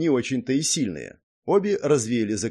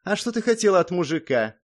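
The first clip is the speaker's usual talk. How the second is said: higher, disdainful woman's voice; dismissive; 7 semitones higher than usual. A narrator's reading. Second guard to the first about men, dismissive. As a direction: brush him off, pitched up. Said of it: "пренебрежительно произнесла вторая".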